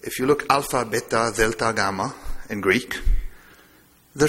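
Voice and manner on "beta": normal talking voice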